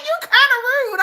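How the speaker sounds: high, laughing imitation of teasing brothers; laughing teasing retort. Brothers tell their sister she's kinda rude, teasing. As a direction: tease in a loud, high-pitched voice.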